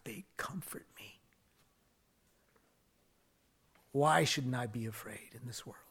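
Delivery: preacher whispering the psalm to the congregation; intimate whisper. Acted. Whispered scripture reading.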